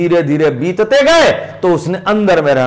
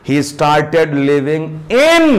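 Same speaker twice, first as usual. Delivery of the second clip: in said with weight at the end; slow line, in pushed out firmly; stress on in; 3 semitones lower, much slower than usual. Shown as a demonstration. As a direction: lean on the final in. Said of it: "देख रहे हैं इन पर stress दे रहे हैं".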